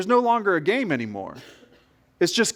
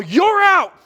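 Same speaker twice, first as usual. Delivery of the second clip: sharp, much higher, loud shout; boy's yell; 11 semitones higher, 6 dB louder than usual. Retold or imitated. Boy yelling at another boy he just hit, loud shout. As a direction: shout it as the ball hits him. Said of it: "and yell".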